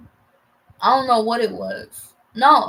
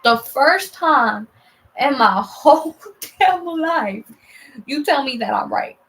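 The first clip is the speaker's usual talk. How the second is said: loud, drawn-out retold disbelief, word by word; amazed emphasis; 3 semitones higher, 6 dB louder, slower than usual. Daughter amazed mom finally agrees, loud slow emphasis. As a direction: hammer each word loudly in mock amazement.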